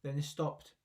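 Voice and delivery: ordinary delivery, nothing marked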